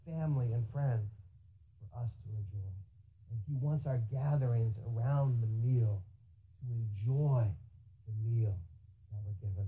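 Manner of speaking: gentle. Acted. Soft closing sermon.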